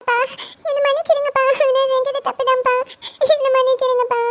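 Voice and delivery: high-pitched crying apology; tearful pleading. Acted. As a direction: beg father's forgiveness through tears. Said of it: "ஐஷுமா, எதுக்கு அழற?".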